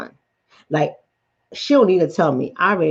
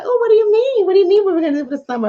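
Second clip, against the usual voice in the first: very high, rushed sweet pretence; fake-polite response; much faster than usual. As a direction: play innocent in a squeaky-high social voice. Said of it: "I don't say that to them".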